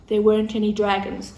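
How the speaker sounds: ordinary delivery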